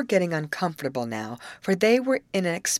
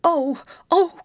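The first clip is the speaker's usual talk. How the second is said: high, loud cries of dismay; alarmed cry; 13 semitones higher, 8 dB louder than usual. A narrator's reading. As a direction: cry out as if disaster has struck. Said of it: "cried Grace".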